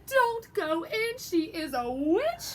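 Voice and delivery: loud, slow, high warning cry; urgent warning. Acted. Chicken warns Hansel and Gretel, loud and urgent. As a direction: call out the warning. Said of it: "little red chicken and she said".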